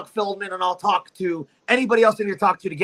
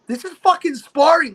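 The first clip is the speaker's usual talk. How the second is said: high-pitched mock protest; retold protest; 7 semitones higher than usual. Cody objecting to his coach over a downed partner, retold high protest.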